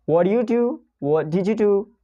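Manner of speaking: normal talking voice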